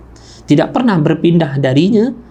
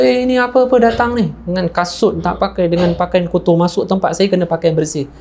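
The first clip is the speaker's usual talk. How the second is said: high-pitched, indignant telling-off; acted scolding, pitched up at the start; scolding official; 4 semitones higher than usual. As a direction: imitate an official rebuking a shabby visitor. Governor scolds poorly dressed widow.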